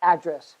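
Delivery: ADdress, stressed on the first syllable. Shown as a demonstration. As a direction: say "address" as the noun, punching "ad". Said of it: "That's why we call".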